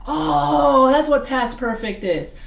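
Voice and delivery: drawn-out mock-student lightbulb moment; dawning realization. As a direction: imitate a student who just got it. Student suddenly understands French term.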